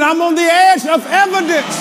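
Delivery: mean every word, shout it; said like you mean it. Shown as a demonstration. Preacher demanding conviction from the congregation, full shout. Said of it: "say it like you mean it".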